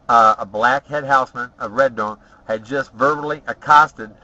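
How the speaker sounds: usual speaking voice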